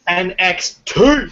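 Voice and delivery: high, slow, put-on tough voice; mock gangster shout. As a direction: stretch the letters like a fake gangster. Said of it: "like, this masculine".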